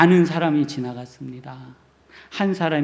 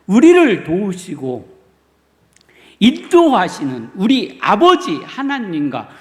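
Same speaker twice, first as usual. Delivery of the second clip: louder, higher preaching in full conviction; fervent preaching; 3 semitones higher, 6 dB louder than usual. Acted. Pastor assures the congregation God repays, fervent preaching. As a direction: proclaim it with rising fire.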